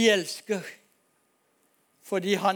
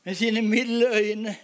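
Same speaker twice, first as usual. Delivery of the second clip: ordinary delivery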